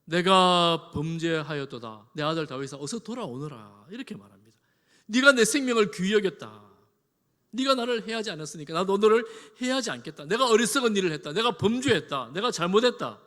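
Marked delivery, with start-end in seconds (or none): 0.0-3.9 drawn-out loud confession, easing into a summons; acted remorseful old-style proclamation; repentant king. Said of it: "급변하여". 5.1-13.2 loud, high-pitched outpouring of guilt; impassioned acted repentance; fervent confession. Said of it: "자신의 잘못을 그대로 고백합니다".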